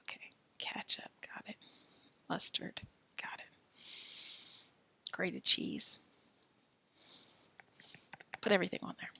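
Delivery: soft whispered self-check; whispered checklist. Real, not acted. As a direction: tick items off under your breath. Whispered self-check while rereading.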